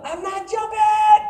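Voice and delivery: high, slow protesting yell; retold yell. Scared jumper yelling back at friends on the dock, high mock yell. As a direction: re-enact a drawn-out, high-pitched refusal. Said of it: "I'm yelling".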